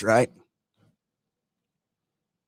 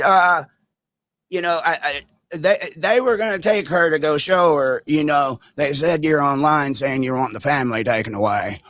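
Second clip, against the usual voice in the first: imitating Larry's accent; 2 semitones higher than usual. Narrator mimics Larry stammering excuses at him. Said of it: "my accent isn't that great".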